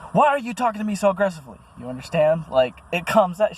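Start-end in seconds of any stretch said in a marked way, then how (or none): 0.1-1.6 high, fast, hammed-up whiny accusation; mock girlfriend. Mocking his girlfriend's accusation, high and fast. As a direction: mimic her shrill accusing whine. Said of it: "this girl would say things like".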